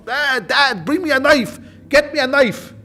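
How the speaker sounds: high, urgent call for a knife; retold scream. Son-in-law calls his father-in-law for a knife, high mock scream. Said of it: "he screams to his father-in-law".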